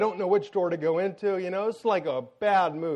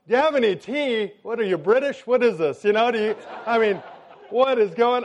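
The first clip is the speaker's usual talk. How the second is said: higher-pitched, exasperated mimicry; baffled, indignant husband; incredulous echo; 4 semitones higher, 3 dB louder than usual. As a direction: throw the question back in comic disbelief. Incredulous husband echoes her question.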